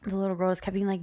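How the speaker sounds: usual speaking voice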